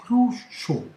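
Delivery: roucho built up slowly, syllable by syllable; sounded-out syllable. Shown as a demonstration. Teacher building roucho step by step. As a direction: join roucho carefully. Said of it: "nous en sommes donc à r".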